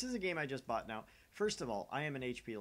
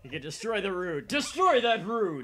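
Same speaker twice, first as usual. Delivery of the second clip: high, hammed-up shout building louder; mock battle cry; 8 semitones higher, 15 dB louder than usual. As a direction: yell it like an overblown war cry. Hams up the mispronounced line.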